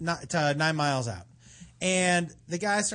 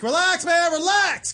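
higher, louder call of relax; 12 semitones higher, 8 dB louder, slower than usual. Retold or imitated. Guide calling to the panicking snorkeler, loud call. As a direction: shout it across the water to calm him.

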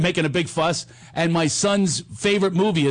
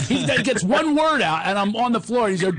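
ordinary talk

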